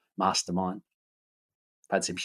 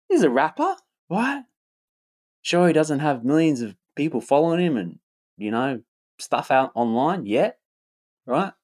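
mock hype voice; 6 semitones higher than usual. Mock hype describing himself.

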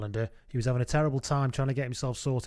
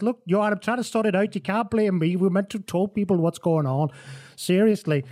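higher, louder, mildly exasperated excuse; exasperated pleading; 7 semitones higher, 4 dB louder than usual. Retold or imitated. Humphries defends himself to Johan, mildly exasperated. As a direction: plead that it isn't your fault.

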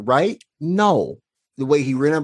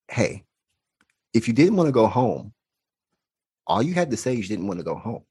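put-on softer, tender words to a crying kid; gentle comfort; 3 dB quieter than usual. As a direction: ease him gently, no blame. Consoling bawling child.

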